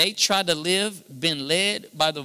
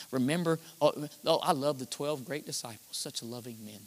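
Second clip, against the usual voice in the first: ordinary talk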